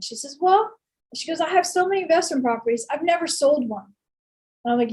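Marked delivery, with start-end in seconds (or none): 0.4-3.9 a hint of a Georgia friend bragging; a friend explaining her properties in a mild Southern accent. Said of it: "in her Southern accent".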